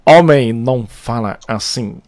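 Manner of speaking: slow, low-pitched pronouncement; deliberate catchphrase. Shown as a demonstration. Host laying down the game's catchphrase, slow and low. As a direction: draw it out low, word by word.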